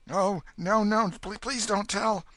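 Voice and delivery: high, stammering frantic plea; desperate plea. Acted. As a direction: beg him not to tell. Huck pleading desperately.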